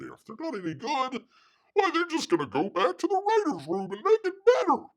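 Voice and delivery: squeaky high mimicry of a know-it-all; mocking the producer. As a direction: parody him as a wide-eyed simpleton. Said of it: "now he's saying".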